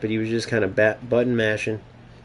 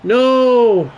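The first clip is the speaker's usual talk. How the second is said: high, loud anguished no; a real cry of dismay; loud despair; 10 semitones higher, 6 dB louder than usual. Real, not acted. Chance lost in the game.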